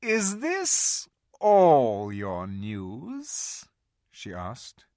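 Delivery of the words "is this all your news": very slow, cold and cruel. A narrator's reading. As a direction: drawl it with a cruel smile. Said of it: "slow, cruel smile".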